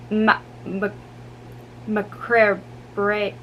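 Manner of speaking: usual speaking voice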